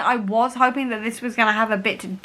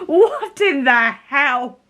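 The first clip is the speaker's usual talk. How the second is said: slow, drawn-out shock with laughter; amused disbelief; much slower than usual. Real, not acted. Reader telling viewers she must carry on, flabbergasted. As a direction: stretch the words, half laughing.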